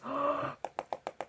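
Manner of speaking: sharp shocked gasp. Retold or imitated. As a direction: gasp in alarm. IPod dies.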